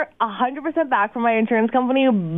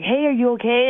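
ordinary delivery

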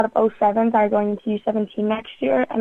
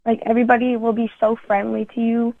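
ordinary talk, nothing marked

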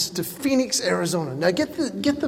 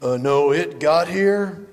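hesitant worker; 7 semitones lower, 3 dB quieter, slower than usual. Retold or imitated. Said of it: "they went".

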